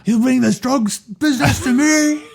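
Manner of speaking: high, strained caricature of a Don; mock-outraged mob Don. Mock Don outraged at drug offer.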